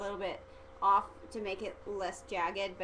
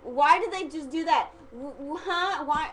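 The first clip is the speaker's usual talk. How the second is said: higher, louder mock-baffled questions; hammed-up confusion, pitched up and insistent; acting clueless; 5 semitones higher, 5 dB louder than usual. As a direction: pester with bewildered questions. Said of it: "I told her, I'm like".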